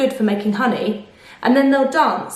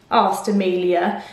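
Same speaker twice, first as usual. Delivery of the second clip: usual speaking voice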